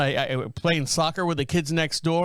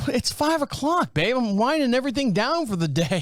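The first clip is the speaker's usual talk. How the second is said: mock whiny excuse; 7 semitones higher than usual. Husband dodging the appointment to wife, higher mock whine. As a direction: stretch it out like a tired excuse. Teasing wife about going.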